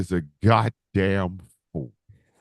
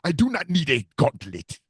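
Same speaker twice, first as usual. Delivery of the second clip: grand villain declaring his power, punched out; deliberate, emphatic supervillain boast; African Thanos; 3 dB louder than usual. Acted. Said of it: "one hell of an African Thanos".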